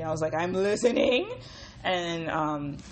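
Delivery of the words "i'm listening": loud, eager reply with rising end; hammed-up keen interest; piqued interest. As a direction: perk right up, louder and lifting at the end. Growing interest in retold reply.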